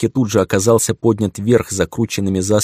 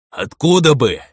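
louder angry growl; 7 semitones higher, 6 dB louder than usual. A narrator's reading. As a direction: snarl it back. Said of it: "прорычал я". (прорычал)

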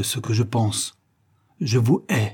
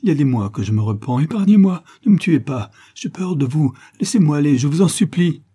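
loud, high terrified begging; panicked pleading, pitched way up; wailing, raised voice; 6 semitones higher, 5 dB louder than usual. A narrator's reading. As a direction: plead frantically for mercy. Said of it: "élevait la voix jusqu'à pousser des hurlements".

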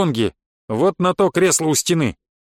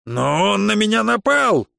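high, drawn-out yell of protest; 6 semitones higher than usual. A narrator's reading. Грабонги accusing the narrator before the guards, yelling. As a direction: cry out the accusation, stretched out. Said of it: "заорал Грабонги".

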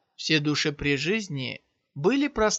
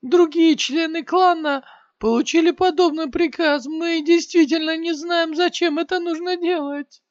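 panicked, crying shriek, much higher and louder; terrified screaming; 13 semitones higher, 7 dB louder than usual. A narrator's reading. Maimed warrior screams to Shiyan in terror. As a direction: beg for your life in panic. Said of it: "Со страхом в голосе закричал воин".

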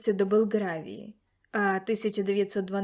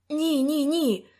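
loud, high, urgent no, no, no; exclaimed; 5 semitones higher, 5 dB louder, slower than usual. A narrator's reading. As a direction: cry out to stop the idea. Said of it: "вигукнув Лукас".

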